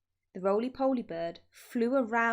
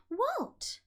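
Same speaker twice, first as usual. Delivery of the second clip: startled little voices, pitched high; high surprised cry. A narrator's reading. The little ones ask their dad, high and startled. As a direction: squeak it in disbelief.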